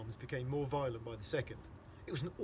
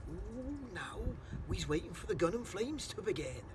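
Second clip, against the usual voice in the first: lightly voiced friendly giant, higher; a hint of the kindly giant waiting; BFG voice; 6 semitones higher than usual. BFG voice in story.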